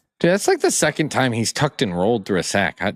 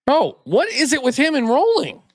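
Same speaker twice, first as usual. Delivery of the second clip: high exasperated disbelief; exasperated exclaim. Real, not acted. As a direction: throw up your hands in disbelief. Exasperated at player rolling.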